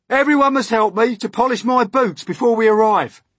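high, loud fussing; agitated demand. A narrator's reading. Centipede needs boots polished before arrival, loud agitated demand.

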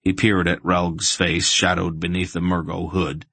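usual speaking voice

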